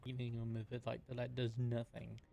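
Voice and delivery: normal talking voice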